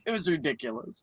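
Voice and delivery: ordinary talk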